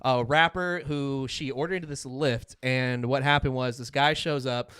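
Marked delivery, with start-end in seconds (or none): none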